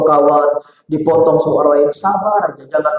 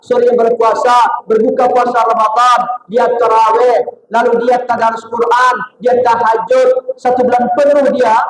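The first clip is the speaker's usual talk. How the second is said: higher, driving sermon cadence; fervent preaching; 6 semitones higher than usual. Acted. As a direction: preach it rising toward the word takwa. Fiery sermon buildup. (ceramah berapi-api)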